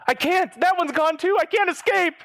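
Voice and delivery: high-pitched, hammed-up desperation; mock panic. Caller telling tech scammer escape key is gone, mock panic.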